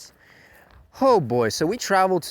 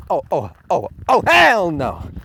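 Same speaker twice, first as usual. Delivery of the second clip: emphatic refusal; 6 semitones higher, 9 dB louder than usual. Retold or imitated. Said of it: "This is where I was like".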